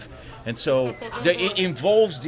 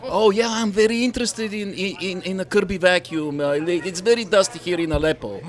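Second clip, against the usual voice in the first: higher-pitched mock Middle Eastern accent; 6 semitones higher, 4 dB louder than usual. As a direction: play an eager customer with a put-on Middle Eastern accent. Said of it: "like, a Middle Eastern accent and say".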